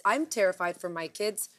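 ordinary delivery

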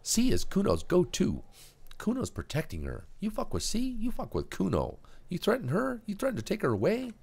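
put-on high, cocky street kid; a tough little punk guarding his turf; Kuno voice. Kuno warning the cop.

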